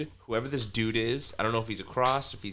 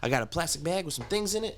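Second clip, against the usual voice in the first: higher, rising frantic list; frantic neighbor; 8 semitones higher than usual. Retold or imitated. Paranoid neighbor complaining about bugs, higher frantic list. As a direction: spill it out in a panic. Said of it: "He's like".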